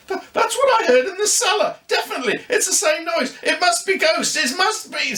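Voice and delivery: put-on a spooked kid insisting it's ghosts; scared boy. Luca frightened by the noise.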